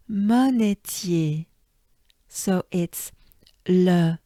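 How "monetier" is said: monetier drawn out slowly; slow drilled Monetier; slow French pronunciation. Shown as a demonstration. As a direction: take Monetier syllable by syllable. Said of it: "we accentuate the E part".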